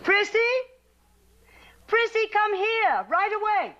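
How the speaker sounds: put-on high, carrying call to a child. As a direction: call her across the camp, urgently. Grandmother calls child from afar.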